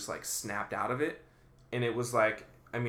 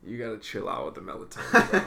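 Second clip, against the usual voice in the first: laughing; 8 dB louder, faster than usual. Real, not acted. Teasing friend while laughing.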